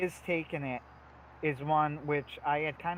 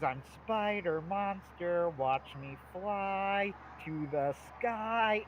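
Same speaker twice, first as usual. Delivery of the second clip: slow, high-ish puppet voice, drawn out; Grover voice; 5 semitones higher, much slower than usual. Retold or imitated. Retelling the Sesame Street skit, slow goofy Grover voice. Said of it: "and he goes".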